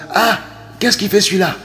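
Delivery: high, nosy gossip voice; gossiping busybody. Retold or imitated. Mocking gossip about the neighbour.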